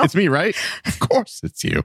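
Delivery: high, breathy mock sweetness; high mock girly voice. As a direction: simper it like a rival. Mocking the fan girl scene.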